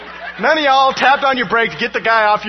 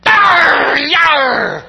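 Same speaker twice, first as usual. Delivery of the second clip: loud high growling yell out the window; mock angry yell; 9 semitones higher, 10 dB louder than usual. Pastor yelling at a tailgating driver, mock fury. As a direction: roar at the tailgater like a pirate.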